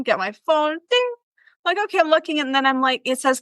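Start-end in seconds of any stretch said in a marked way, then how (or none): none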